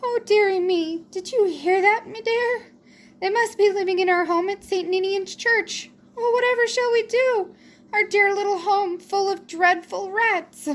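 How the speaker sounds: put-on tearful old mouse fretting to her husband; sobbing church mouse. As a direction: wail it like a frightened old mouse wife. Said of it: "Miss Church Mouse began sobbing".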